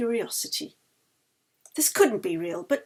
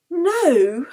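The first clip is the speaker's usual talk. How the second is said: shocked no; 6 semitones higher, 7 dB louder than usual. Acted. Character shocked outburst.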